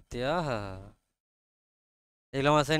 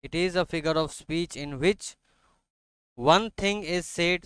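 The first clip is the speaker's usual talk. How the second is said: very slow, measured dictation; much slower than usual. Real, not acted. Teacher dictates a definition to students, slow and deliberate. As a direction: spell it out phrase by phrase for note-takers.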